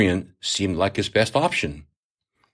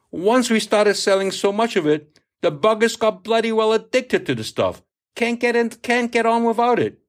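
higher, emphatic salty sea captain; worked-up old captain; 11 semitones higher than usual. A narrator's reading. Captain scoffs at addicted buyers, emphatic and higher. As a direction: drive it home with scorn.

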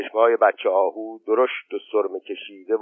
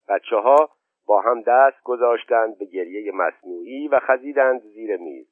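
ordinary delivery, nothing marked

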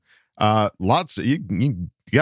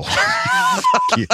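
very high squealed shout; mock outraged yell; 3 dB louder than usual. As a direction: shriek it in fake fury.

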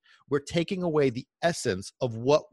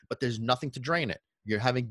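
ordinary talk

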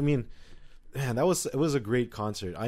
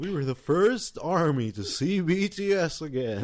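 higher, slow put-on storyteller voice; mock-grand reminiscing; 5 semitones higher, slower than usual. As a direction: proclaim it slowly as if recounting legend. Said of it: "I don't know why I'm talking like this".

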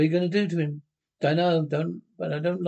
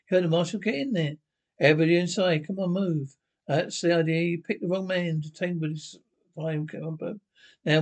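usual speaking voice

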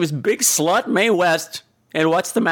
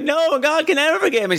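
much higher, wailing mock shout of guilt; hammed-up confession; 11 semitones higher than usual. Shelley Winters confessing to the radio preacher, hammed-up wailing shout. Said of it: "And she's like".

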